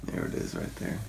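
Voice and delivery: low unvoiced whisper. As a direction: drop to a secret whisper. Mock reveal whisper.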